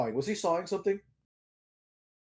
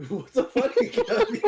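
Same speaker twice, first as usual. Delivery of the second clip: laughing. Real, not acted. Bursting out laughing at a friend.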